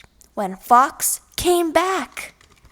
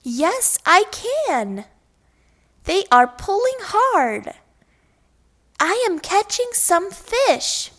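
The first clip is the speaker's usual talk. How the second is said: excited bear; 7 semitones higher than usual. Acted. Bear eagerly tells Fox he feels fish, excited. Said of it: "Bear said".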